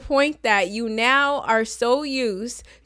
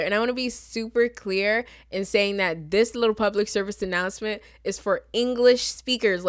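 ordinary delivery

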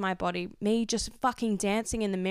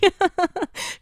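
sudden high giggle; high burst of laughter; 11 semitones higher, 7 dB louder than usual. Real, not acted. Host laughs at herself for ranting at listeners, high giggle. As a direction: laugh at yourself. Laughing at her own rant.

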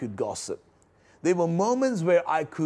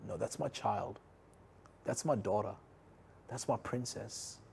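soft tender father's voice, much quieter; tender voice of God; 4 semitones lower, 10 dB quieter than usual. Retold or imitated. God protecting his child, voiced soft and tender. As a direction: whisper it like a loving father. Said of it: "like God was furious, but pointed".

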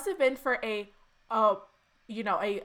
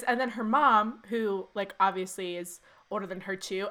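usual speaking voice

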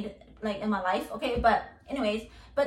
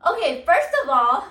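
loud, higher-pitched objection; indignant outburst; 4 semitones higher, 10 dB louder than usual. Real, not acted. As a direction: burst out loudly in indignation. Indignant reply to the comment.

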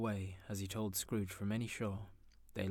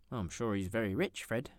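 hinting; 3 semitones higher, 3 dB louder than usual. Acted. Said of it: "hinted Scrooge's niece".

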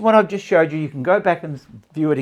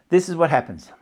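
normal talking voice

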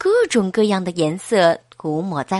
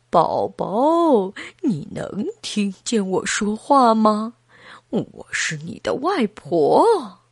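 slightly lowered grandma voice; lightly lowered voice of a granny, drawing out the end; grandmother; slower than usual. Acted. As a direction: play a grandma talking to the unborn baby. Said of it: "崔西太太的妈妈来了".

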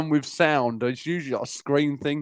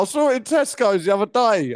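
normal talking voice

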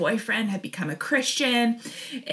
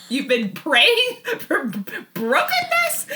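slow, rising to a very high disbelieving question; incredulous; 12 semitones higher, 4 dB louder, slower than usual. Retold or imitated. To the mentor praying for her brokenness, a very high disbelieving question. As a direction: ask it in shocked disbelief.

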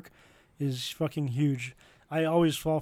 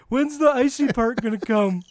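higher, louder mock desperate yell; imitated yelling; 8 semitones higher, 6 dB louder than usual. Friend retells Julian's Icy Hot panic, imitated yelling. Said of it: "you just yelling out".

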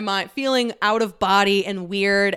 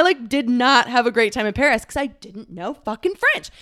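ordinary delivery

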